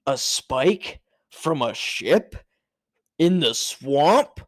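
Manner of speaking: slow, dramatic documentary narrator; narrator impression. Imitating the show's narrator reacting to the find. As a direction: stretch each word like a grave mystery. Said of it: "all you hear is the narrator, he goes".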